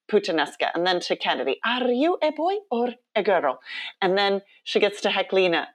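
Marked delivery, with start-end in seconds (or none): 1.6-3.6 put-on higher little-girl voice, Icelandic accent. Little girl asking the next queen, imitated Icelandic accent. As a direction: mimic the child's question in an Icelandic accent. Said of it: "she's in her little Icelandic accent".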